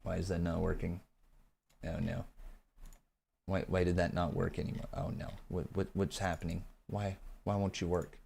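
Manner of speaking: quiet frustrated fumbling; muttering to self. Real, not acted. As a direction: troubleshoot under your breath. Fixing stream, talking to self.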